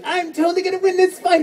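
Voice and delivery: squeaky little frog, full of confidence; cocky high frog voice. Retold or imitated. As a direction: play a tiny overconfident frog. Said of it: "Dororo would be like".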